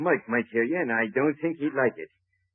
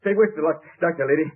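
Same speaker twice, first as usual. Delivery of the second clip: louder and higher, pushing for the doctor; urgent demand; 6 semitones higher, 6 dB louder, faster than usual. Acted. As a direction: bark out the demand in a hurry. Thug bursts in demanding doctor.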